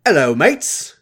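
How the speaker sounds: loud, bad Cockney accent; [name]. [name] comes in on the sisters, awful Cockney accent.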